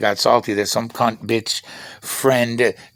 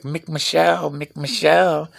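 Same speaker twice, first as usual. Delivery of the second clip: mocking taunt; 7 semitones higher than usual. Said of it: "He was like".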